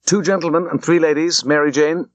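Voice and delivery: calling out. A narrator's reading. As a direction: call it out briskly over the bustle.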